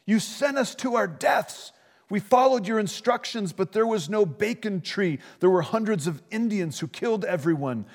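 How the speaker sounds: high, furious yell of a dying man; furious shouting. Acted. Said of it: "the near-dead man starts shouting".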